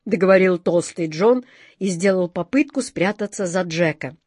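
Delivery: ordinary delivery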